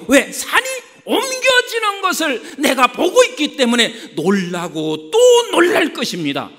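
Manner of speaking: high, loud, shouted sermon; full-force preaching. Acted. Preacher promises the congregation wonders to come, full-force preaching. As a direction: build to a pulpit shout.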